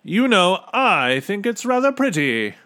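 loud, high, breezy character voice; hearty, condescending officer; amused captain. A narrator's reading. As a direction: brush off the worry jovially. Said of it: "laughed the captain".

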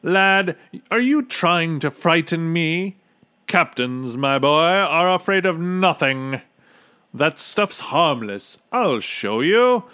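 loud, high, boastful officer; slowing into a drawn-out boast; scoffing captain. A narrator's reading. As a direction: puff it up with bravado. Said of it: "snorted the captain".

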